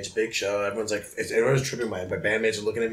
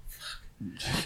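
whispered curse; 14 dB quieter than usual. Retold or imitated. Hearing detectives at the door.

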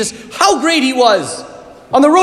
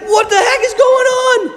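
high, raised cry of confusion; shocked; 9 semitones higher than usual. Retold or imitated. Disciples running to the tomb, high shocked cry. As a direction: pitch it up high, totally thrown. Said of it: "And they're like".

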